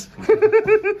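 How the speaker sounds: very high, loud laugh; squealing laugh. Real, not acted. Laughing at the hot food.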